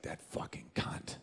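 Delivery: slow, very soft whispered aside; whispered mock contempt; venomous whisper. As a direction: imitate a wife whispering an insult under her breath. Wife's aside while walking in.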